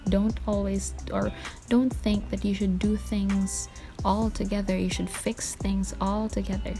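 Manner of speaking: usual speaking voice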